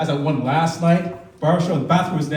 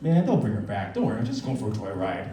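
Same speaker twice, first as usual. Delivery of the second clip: lightly voiced kidnapper; 6 semitones lower, 7 dB quieter than usual. Imagined kidnapper voice.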